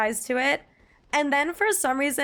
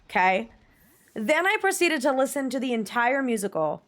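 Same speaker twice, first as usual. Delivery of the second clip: ordinary talk, nothing marked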